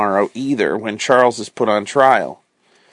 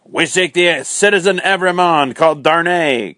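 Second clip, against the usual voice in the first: crying out; 7 semitones higher than usual. A narrator's reading. Armed man bursting in demands the prisoner, calling out higher. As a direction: announce the arrest from the doorway. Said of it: "cried one".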